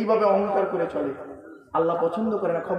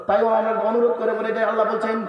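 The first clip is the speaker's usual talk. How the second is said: usual speaking voice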